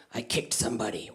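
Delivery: low hushed confession. Retold or imitated. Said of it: "actor confesses in elevator".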